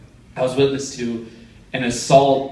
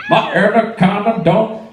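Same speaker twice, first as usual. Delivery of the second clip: higher, put-on hook delivery; mimicked song hook; 6 semitones higher than usual. Comic mocking a music video, exaggerated hook imitation. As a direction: belt out a mock pop hook.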